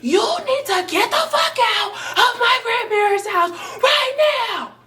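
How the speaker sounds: high shouted order; shrill shout ordering her boyfriend out; retold yelling. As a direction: yell him out of the house. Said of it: "I'm yelling at him".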